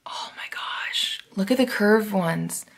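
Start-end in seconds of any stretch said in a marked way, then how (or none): 0.0-1.2 slow hushed whisper of awe; soft slow whispered gasp of delight; awed whisper. Real, not acted. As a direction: breathe it out in wonder. Awed at curved tweezers.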